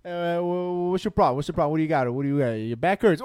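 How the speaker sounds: light New York Italian tough guy; New York Italian accent. Retold or imitated. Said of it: "old school Italian guy from New York".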